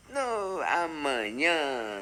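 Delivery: normal talking voice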